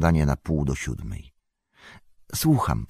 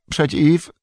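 high cracked break on przeciw; innkeeper's voice breaks from fear; 12 semitones higher, 7 dB louder than usual. Acted. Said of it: "głos mu się załamał".

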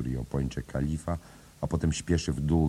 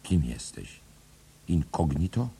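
stunned. A narrator's reading. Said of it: "Bella osłupiała".

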